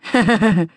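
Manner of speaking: short teasing chuckle. A narrator's reading. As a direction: chuckle briefly at someone's expense. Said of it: "chuckled Bro".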